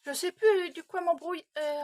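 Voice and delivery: ordinary delivery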